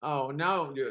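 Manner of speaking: ordinary talk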